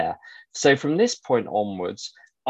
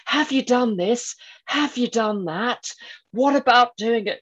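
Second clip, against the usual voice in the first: high, insistent voice of an antivirus alert; mock pestering questions, pitched way up; nagging software pop-up; 12 semitones higher than usual. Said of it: "it's like McAfee. It throws up".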